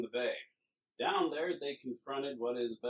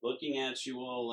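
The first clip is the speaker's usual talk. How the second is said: ordinary talk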